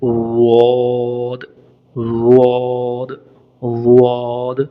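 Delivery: वाद very slow and heavy; tongue between teeth. Shown as a demonstration. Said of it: "उसके बीच में रख के कहें". (ज़ुबान दांतों के बीच रखकर वाद)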